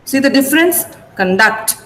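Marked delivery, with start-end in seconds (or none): 1.1-1.8 Conduct as cun-DUCT; second-syllable stress. Shown as a demonstration. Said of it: "I'm stressing duct".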